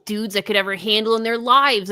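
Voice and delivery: ordinary delivery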